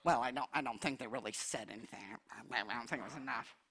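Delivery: very low, hushed grumble; doubtful muttering. Acted. Altar policeman judging someone's tongues, muttering doubtfully. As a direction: mutter the doubt under your breath.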